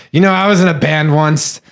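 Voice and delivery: put-on slurred, boastful drunk; lightly voiced tipsy fan. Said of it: "like, in a drunk voice".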